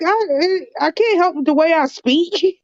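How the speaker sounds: high-pitched, exasperated protest; defensive retort. Retold or imitated. To her friend's grandmother who mocks northern talk, high defensive protest. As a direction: squeak it out, defending how you talk. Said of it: "I'm like".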